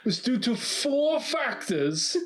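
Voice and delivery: slow, high, hammed-up posh British read; bad British accent. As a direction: read it like a stuffy British lecturer. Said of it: "Such a bad accent".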